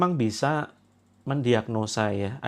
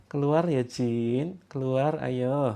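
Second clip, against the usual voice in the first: too gentle; much slower than usual. Shown as a demonstration. Demonstrating the wrong timid way to order a jinn out, slow. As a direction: coax the jinn out far too politely. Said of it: "orang Jawa itu kan biasanya pelan".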